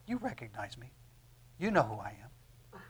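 low, soft voicing of a quote; hushed recognition. Preacher voicing the blind man's moment of recognition, hushed. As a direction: say it low and softly, letting each phrase settle.

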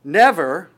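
NEVER hit loud and rising; stress on never. Shown as a demonstration. Preacher stressing "Never" to the congregation, loud and rising. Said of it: "just want to emphasize the word never".

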